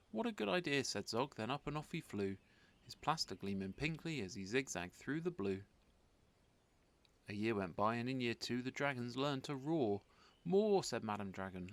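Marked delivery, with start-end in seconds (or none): 0.1-0.8 high, eager exclamation; young dragon thrilled by the offer; delighted. A narrator's reading. Zog delighted by idea. 10.4-10.8 high, loud command; Madam Dragon urging pupils louder; demanding teacher. A narrator's reading.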